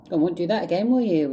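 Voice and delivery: high, loud mock-mum voice; mum on the phone. Mum chiding her child in prison, high loud imitation. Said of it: "My mum would call me".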